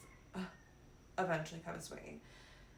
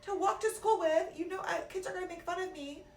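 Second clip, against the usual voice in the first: mock child's protest, pitched way up; complaining child; 12 semitones higher, 4 dB louder than usual. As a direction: whine high like a kid pleading with a parent. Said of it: "you're like".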